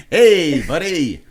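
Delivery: high, loud "Hey, buddy" in a hammed-up Canadian accent; mock Canadian accent. Host mocks cohost's bad Canadian impression, loud mock Canadian. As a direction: bark a cartoon Canadian greeting. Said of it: "worst attempt of the Canadian".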